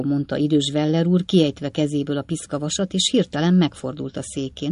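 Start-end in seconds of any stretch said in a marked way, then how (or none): none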